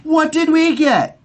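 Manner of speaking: angry fan yelling at the screen; heated rant. Real, not acted. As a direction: tell the villain off, furious fan. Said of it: "I am very heated about this subject".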